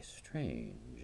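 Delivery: ordinary delivery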